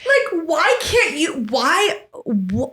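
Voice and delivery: slow, high, disbelieving; baffled. Real, not acted. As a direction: search for words in disbelief. Baffled by older adults.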